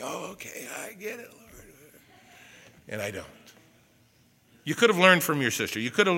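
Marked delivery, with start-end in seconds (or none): 0.0-1.4 mock meek reply.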